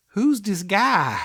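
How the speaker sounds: slow, high Southern drawl; Foghorn Leghorn drawl. Retold or imitated. Host imitating the actress seeing the picture, exaggerated Southern drawl. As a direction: stretch it out in a hammed-up Southern accent. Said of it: "out here foghorn leghorning up".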